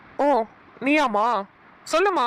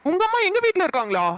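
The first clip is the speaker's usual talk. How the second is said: startled question; 6 semitones higher than usual. Acted. Shocked her mother is at his house.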